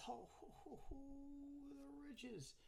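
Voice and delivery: high soft mock-greedy chuckle; gleeful gloat. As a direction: savor the riches like a miser. Gloating over a rare pull.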